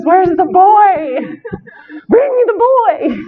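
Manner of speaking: put-on high, slow, drawn-out wail for the boy; hammed-up cry. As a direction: wail "Where's the boy?" high and dragged out like a desperate villain. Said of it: "I'm like".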